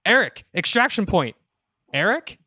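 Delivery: high-pitched call for help, ending on a questioning name; yelling for extraction. Acted. Raven calls her partner for extraction, yelling. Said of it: "She's yelling into her communication device".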